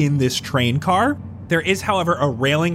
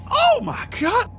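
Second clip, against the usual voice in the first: excited shout. Acted. NPC overjoyed at found dirt.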